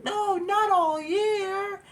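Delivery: put-on very high, drawn-out whining kid; whiny plea. Boy begging his dad to shorten the punishment, whining. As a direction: stretch it out like a begging child.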